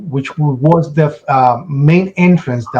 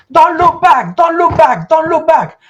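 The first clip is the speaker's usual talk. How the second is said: screaming warning; 11 semitones higher than usual. Retold or imitated. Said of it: "starts screaming".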